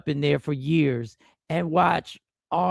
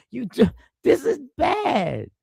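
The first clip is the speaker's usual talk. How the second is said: exasperated shout; 7 semitones higher, slower than usual. Real, not acted. Exasperated repeat, shout heard.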